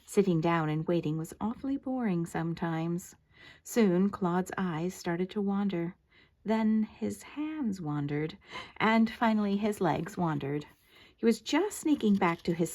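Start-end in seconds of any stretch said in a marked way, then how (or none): none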